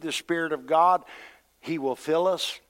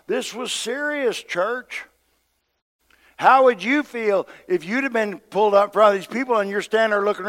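raised, driving preacher's voice; emphatic preaching; 6 semitones higher, 5 dB louder than usual. Real, not acted. Preacher stressing how serious Peter's accusers were, forceful preaching. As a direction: press the gravity on the congregation, pitched high.

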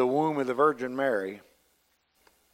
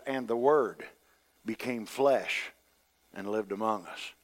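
hushed scripture reading; hushed reverence; 6 semitones lower, 8 dB quieter than usual. Acted. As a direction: lay the verse down gently, in awe. Quoting John reverently.

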